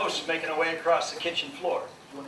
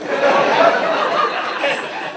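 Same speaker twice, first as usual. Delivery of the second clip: high laugh; 9 dB louder than usual. Real, not acted. Laughter burst.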